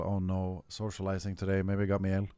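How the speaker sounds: usual speaking voice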